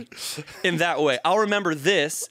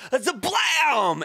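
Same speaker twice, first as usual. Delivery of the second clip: mock crash; 9 semitones higher, 4 dB louder, much slower than usual. Crash sound effect.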